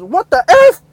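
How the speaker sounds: loud high disbelief; shocked shout. Retold or imitated. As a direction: blurt it out, stunned. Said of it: "he goes".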